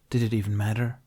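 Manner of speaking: plain reading voice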